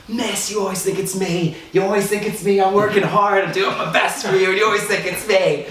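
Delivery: high whiny schoolboy pleading; hammed-up whiny plea of innocence; mock-innocent protest. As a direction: act wounded innocence to fool the teacher. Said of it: "I try the reverse psychology route".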